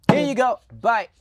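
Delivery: high and loud scornful mimicry of officials tossing out gifts; mock dismissive handout. Mocking how the ruling party treated voters, in a sneering, dismissive voice.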